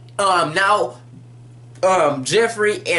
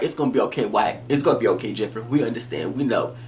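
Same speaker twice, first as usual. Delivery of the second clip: mock soothing; 8 semitones lower, 4 dB quieter, faster than usual. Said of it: "rubbed on him, like".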